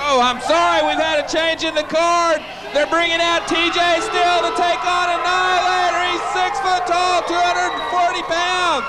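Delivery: high, sustained announcing pitch; projected, level-toned arena announcement; ring announcer. Real, not acted. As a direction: call out a card change to the whole arena. Announcer corrects the card to the arena.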